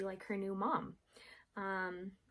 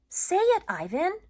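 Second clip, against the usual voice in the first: louder, insistent dog voice; Bob commanding; 12 semitones higher, 10 dB louder than usual. Acted. Bob urging Ivan, insistent. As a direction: demand it playfully. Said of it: "Bob commands".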